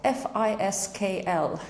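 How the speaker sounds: F I S K L letter by letter, slowly; spelled out. Shown as a demonstration. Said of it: "How do you spell Fiscal?".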